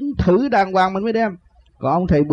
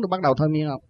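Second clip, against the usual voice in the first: ordinary delivery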